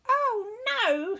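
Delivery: high, loud alarmed shout; high alarmed witch shout. Acted. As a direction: cry out in alarm. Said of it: "shouted Winnie".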